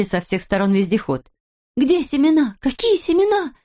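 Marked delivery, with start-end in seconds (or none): 1.8-3.5 high-pitched eager questions; clamouring shouts. A narrator's reading. Villagers crowding round the vehicle, shouting questions excitedly. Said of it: "наперебой кричали они".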